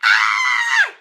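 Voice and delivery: high, loud, fast excited shout; super hyped. Real, not acted. Host shouting her excitement at the hundredth show. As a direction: explode with excitement. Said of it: "Can you tell I'm super hyped?".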